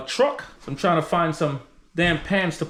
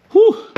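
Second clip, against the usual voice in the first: whew; 7 dB louder than usual. Real, not acted. Oil gushes out.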